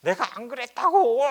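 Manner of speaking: put-on loud, tearful protest of a wronged child; older brother crying out in his own defense, loud and tearful; crying outburst. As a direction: shout it through tears, a boy insisting he didn't do it. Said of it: "그러면서 형이 이제 울었어요".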